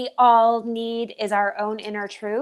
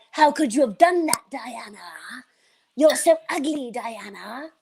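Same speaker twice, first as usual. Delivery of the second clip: lightly voiced inner critic jabbing at her; shame's nagging voice. Diana's shame character mocking her looks and choices. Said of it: "shame, if he's like".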